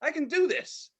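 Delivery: high, pleased "This is good"; delighted realization. Retold or imitated. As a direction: discover a new trick with glee. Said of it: "And I was like".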